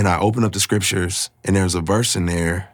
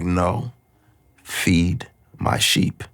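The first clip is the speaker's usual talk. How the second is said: slow, softer tender reply; gentle reassurance; 3 semitones lower, 3 dB quieter, much slower than usual. Retold or imitated. God answering his despair, slow soft tender voice. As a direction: ease it out slowly, gently insisting.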